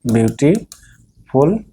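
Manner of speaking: dictating beautiful at a crawl; slow dictation. Shown as a demonstration. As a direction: spell the word beautiful out slowly for students. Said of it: "more beautiful".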